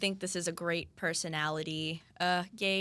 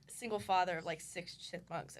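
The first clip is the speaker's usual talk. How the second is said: ordinary delivery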